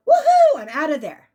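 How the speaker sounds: a high, loud whoop of release, then the words at normal level; joyful whoop. Retold or imitated. Brother-in-law's spirit whooping with relief at being free. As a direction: cheer like a man finally let out. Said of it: "immediately he was like".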